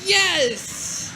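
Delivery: mock cheer. Ironic cheer after tweet.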